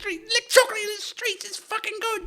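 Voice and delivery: very high squeaky voice, laughing; scamp kid. Pretend. Urchin relishing street chocolate, high squeal. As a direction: squeal it with glee.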